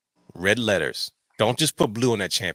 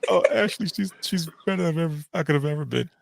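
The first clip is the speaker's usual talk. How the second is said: mock crying; 4 semitones higher than usual. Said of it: "You guys hear my crying voice?".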